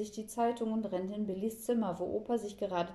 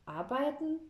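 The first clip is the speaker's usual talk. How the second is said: indignant question, pitched up; 7 semitones higher than usual. A narrator's reading. Child affronted at grandpa suggesting work, indignant. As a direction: echo it back in affront. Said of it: "sieht ihn empört an".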